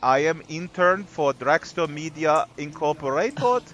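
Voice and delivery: mock Romanian accent, slow; hammed-up Romanian accent; weird Romanian accent. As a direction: play the shy Romanian intern in a mock Romanian accent. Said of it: "do this entire show in this weird accent".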